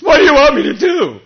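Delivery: loud, high, tearful pleading; crying plea. Acted. Broken Saul begging the Lord for orders, tearful cry. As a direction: beg through tears.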